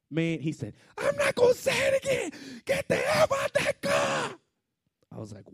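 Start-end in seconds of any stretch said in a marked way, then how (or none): 1.0-4.4 very high, loud screamed warning; screaming police officer. Retold or imitated. Officer repeating his order to the driver, furious shout. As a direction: yell the order as a last warning. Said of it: "screamed in the window".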